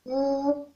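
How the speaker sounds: Ou held level and soft, below the high one; mid tone. Shown as a demonstration. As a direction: say Ou evenly and softly, just under the top. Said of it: "Ton moyen". (« Ou » au ton moyen)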